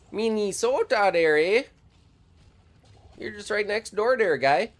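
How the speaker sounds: put-on high, loud, sing-song Minnesota accent; hammed-up Upper Midwest accent. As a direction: lay on the "don't you know" Minnesota charm. Jokey Minnesota greeting.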